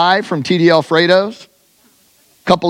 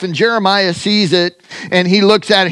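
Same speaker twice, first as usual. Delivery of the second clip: ordinary talk, nothing marked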